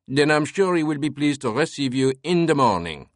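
put-on French-accented servant, irritated. A narrator's reading. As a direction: answer curtly in a French accent. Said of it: "an irritated French accent spoke".

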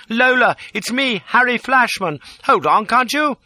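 high, loud, urgent calling out; bellowing. A narrator's reading. Man running after Lola's coach, bellowing. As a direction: shout to be recognised from behind.

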